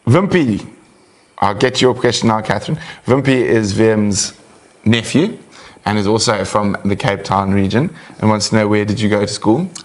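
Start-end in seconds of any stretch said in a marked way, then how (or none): none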